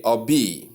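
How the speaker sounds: "obey" ending in bee; mispronunciation demo. Shown as a demonstration. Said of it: "Common error".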